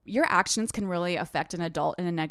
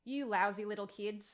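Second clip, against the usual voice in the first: put-on quiet practice run in an Australian accent; trying Australian accent; 11 dB quieter than usual. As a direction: test the Australian accent softly to yourself. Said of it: "lousy is hard to say in Australian".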